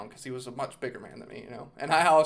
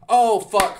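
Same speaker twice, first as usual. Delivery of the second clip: loud outburst; 7 semitones higher, 12 dB louder than usual. Real, not acted. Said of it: "I missed the best part".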